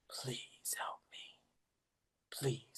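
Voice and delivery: slow, faint dying whisper; fading whisper. Retold or imitated. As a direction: let the plea die away to a breath. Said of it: "the please was getting slower and lighter".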